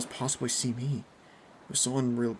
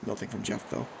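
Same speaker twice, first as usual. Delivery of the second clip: plain reading voice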